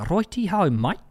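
jokey Aussie sign-on, rising on "mate"; Aussie "mite"; Australian accent. Acted. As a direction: put on a mock-Australian twang. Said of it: "That's Australia".

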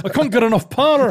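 hammed-up Scottish engineer panicking; Star Trek Scottish. Host mimics the Star Trek engineer in Scottish accent. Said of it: "it was Star Trek".

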